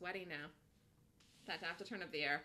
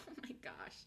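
breathy whispered aside; 13 dB quieter than usual. Real, not acted. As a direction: breathe it out under your breath. Whispered aside during trade.

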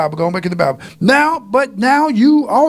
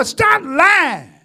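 shouted command; 6 semitones higher than usual. Real, not acted. Preacher shouting at congregation.

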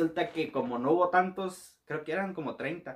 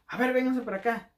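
loud, higher command; barked order; 7 semitones higher, 5 dB louder than usual. Retold or imitated. Soldier ordering the recruits over, loud. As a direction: order them over sharply.

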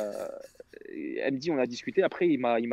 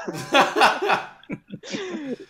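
loud laugh; 5 dB louder than usual. Real, not acted. Teller bursts out laughing.

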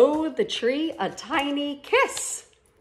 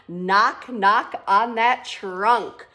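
plain reading voice

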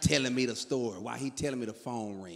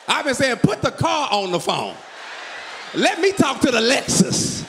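high, loud mock outrage; loud comic rant; 9 semitones higher, 14 dB louder than usual. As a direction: yell it like a furious husband. Comic rant about wife.

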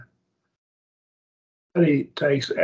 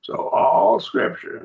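"all" punched higher and louder, slow; emphatic all Scripture; 9 semitones higher, 4 dB louder, slower than usual. Shown as a demonstration. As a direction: lift "all" high and slow it down. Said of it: "to make it emphatic".

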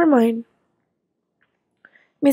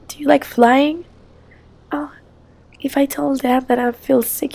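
normal talking voice

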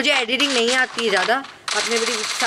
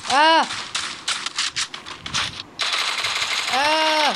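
sharp cry; 6 semitones higher than usual. Real, not acted. Hit in game.

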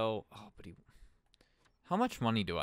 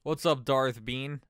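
loud greeting; 5 semitones higher, 11 dB louder, slower than usual. Real, not acted. Greeting a chat viewer.

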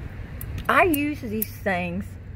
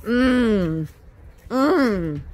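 contented hum of tasting; savouring hum; 3 dB louder than usual. Real, not acted. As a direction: hum over a tasty bite. Savouring a bite of cheesecake.

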